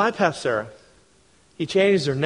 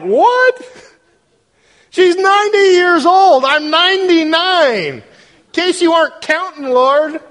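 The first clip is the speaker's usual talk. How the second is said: high, loud disbelieving squeal; Abraham can't believe God's promise, pitched way up; incredulous Abraham; 13 semitones higher, 7 dB louder, slower than usual. Acted. Pastor voicing Abraham laughing at God's promise, incredulous high squeal. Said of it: "He's like".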